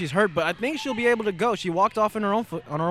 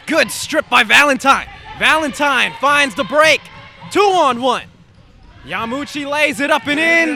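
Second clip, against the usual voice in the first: excited call; 7 semitones higher, 4 dB louder than usual. Real, not acted. Fast break call.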